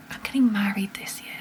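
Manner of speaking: soft unvoiced whisper to herself; whispered disbelief. Real, not acted. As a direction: whisper "I'm getting married this year" in awe. Repeats the line whispered.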